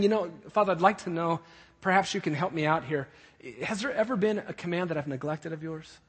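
polite, tentative enquiry; asking a question. Acted. Son asking his father for help, politely questioning. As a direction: put it gently as a humble question. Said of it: "He's not asking a question".

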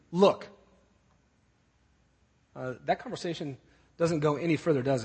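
curt, disrespectful son to his dad. Acted. Son talking back to his dad, disrespectful. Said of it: "What disrespect".

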